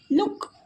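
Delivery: लुक with a brief oo; short vowel. Shown as a demonstration. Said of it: "short sound".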